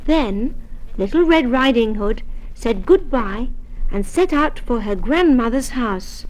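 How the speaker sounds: usual speaking voice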